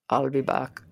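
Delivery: low terminator movie voice; a deep action hero catchphrase; Arnold Schwarzenegger. Retold or imitated. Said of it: "in the words of our friend Arnold Schwarzenegger".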